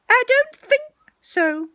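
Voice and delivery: very high, loud put-on voice; disguised voice. Rabbit denying it is him, disguised voice. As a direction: pitch it right up to hide who you are. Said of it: "in a different sort of voice".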